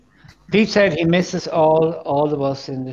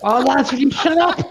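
raised, higher-pitched exasperated plea; exasperated telling-off; 7 semitones higher than usual. Real, not acted. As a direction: cut through the chatter, fed up with the lads. Host scolds rowdy co-hosts over guest.

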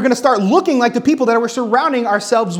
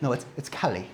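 soft, lower imitation of a child's Irish-tinged "Kelly"; slight Irish accent; 9 semitones lower, 13 dB quieter than usual. Daughter corrects parents on a surname, slight Irish accent. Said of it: "this tiny little accent".

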